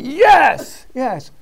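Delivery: group shout of yes, loud and high; resounding yes. Retold or imitated. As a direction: boom out the answer in unison. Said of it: "they give a resounding".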